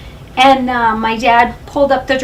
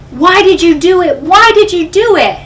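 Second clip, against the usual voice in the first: loud, raised retold demand; heated accusing question, higher and louder; dad's angry accusation; 6 semitones higher, 7 dB louder than usual. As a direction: replay an upset father confronting his child.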